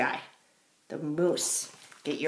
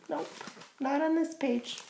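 usual speaking voice

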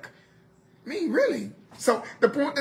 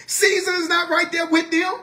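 ordinary delivery

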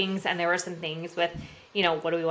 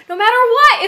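much higher and louder, ringing out; shouted climax; 15 dB louder than usual. Real, not acted. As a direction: shout it with full conviction. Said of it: "be so committed".

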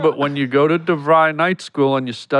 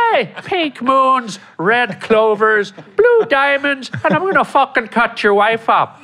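Lucky the leprechaun voice; 7 semitones higher than usual. Pretend. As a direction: do a creepy Irish leprechaun. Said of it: "Think Lucky".

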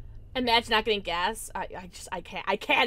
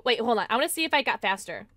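ordinary delivery

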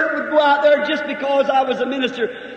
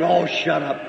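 Bert's gruff brush-off; 8 semitones lower than usual. Retold or imitated. Bert brushes off the preacher.

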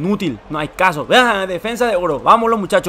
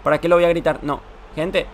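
ordinary talk

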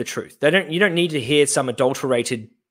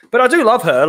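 mock earnest; 9 semitones higher, 6 dB louder than usual. Mock pleading exaggeration.

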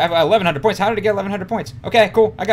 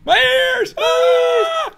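high, loud hammed-up yell of pain; mock pained shout; 5 dB louder, much slower than usual. Teasing friend over loud mic, hammed-up shout. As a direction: scream it like your ears hurt. Mocking the loud Spy's cry.

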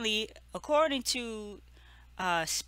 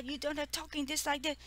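higher-pitched imitation of someone going off; mock going off; 7 semitones higher, 6 dB quieter, faster than usual. Vlogger imitating Spice going off on Erica, mock rant. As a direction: rush it in a high, ranting imitation. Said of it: "She's going off on Erica".

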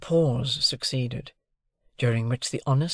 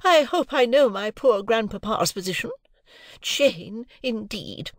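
much higher, slow, haughty lady voice; lofty wife scorning the girl; majestic Mrs Pocket; 9 semitones higher than usual. A narrator's reading. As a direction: look down your nose and drag it out. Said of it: "with a majestic glance".

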